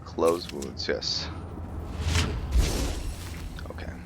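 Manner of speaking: ordinary delivery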